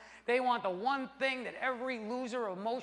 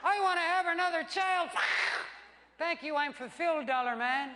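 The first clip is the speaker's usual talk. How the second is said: mock wife voice; 9 semitones higher than usual. Wife thanking the dollar man, high mock voice. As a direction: squeak it like a placated wife.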